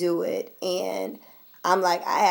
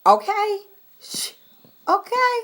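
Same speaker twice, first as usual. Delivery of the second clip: hushing someone; 13 semitones higher, 4 dB louder than usual. Real, not acted. Vlogger hushing someone off camera, then a high loud okay.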